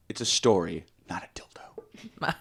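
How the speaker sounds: whispered aside, breathy and secret; a sly stage whisper. Real, not acted. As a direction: lean in and whisper the punchline. Joking aside to Becky.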